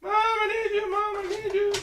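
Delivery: very high, loud wail; mock crying. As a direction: wail for mother like a hammed-up crybaby. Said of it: "starts crying".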